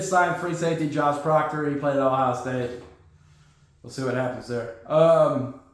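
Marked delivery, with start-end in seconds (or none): none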